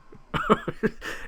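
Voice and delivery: high, breathy giggling burst; real laughter. Real, not acted. Streamer amused by an actor's delivery, high real laugh. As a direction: crack up helplessly.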